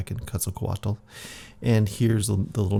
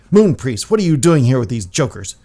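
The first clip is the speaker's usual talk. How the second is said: loud, sharp rebuke of the priest; angry scolding; 4 semitones higher, 9 dB louder than usual. Acted. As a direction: burst in and tell them off. Jaguar Priest barges in scolding.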